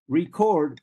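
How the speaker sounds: "record" said re-CORD, second syllable loud and drawn out; stress on second syllable. Shown as a demonstration. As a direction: slowly punch the end of "record". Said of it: "The second syllable is stressed".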